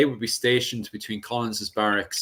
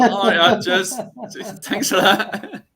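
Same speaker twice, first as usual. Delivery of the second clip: laughing; 9 semitones higher, 3 dB louder, slower than usual. Real, not acted. Laughing at the jab.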